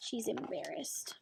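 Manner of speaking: whispered aside to the class. Real, not acted. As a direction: hush it like a secret. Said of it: "teacher explains feeling".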